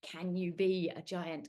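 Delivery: ordinary delivery, nothing marked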